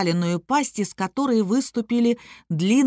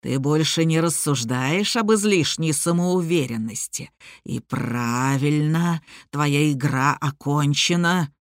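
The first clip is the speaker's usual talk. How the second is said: low, slow growling monster; inhuman growling voice; 6 semitones lower than usual. A narrator's reading. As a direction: let a tiger growl sound through. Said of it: "почти нечеловеческим голосом, ... тигриного рычания". (низкий рычащий нечеловеческий голос)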